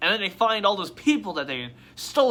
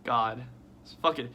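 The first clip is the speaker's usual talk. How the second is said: normal talking voice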